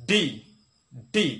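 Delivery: "ডি" loud, d released in a burst; correct letter D. Shown as a demonstration. Teacher drills the correction, "ডি" struck hard. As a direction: punch each "ডি" on its own. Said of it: "আমাদের হবে".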